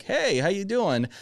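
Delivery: high, slow, drawn-out friendly hello; cheery greeting. Retold or imitated. New salesman greeting a furious customer, cheery and innocent. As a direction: play innocently chirpy, stretching it out.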